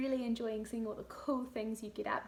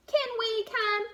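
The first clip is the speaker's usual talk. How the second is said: put-on much higher, slow pleading boy; child's voice; 11 semitones higher, 8 dB louder, slower than usual. Lester asking to follow his mum upstairs, high eager child. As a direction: ask like an eager little boy. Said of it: "asked Lester".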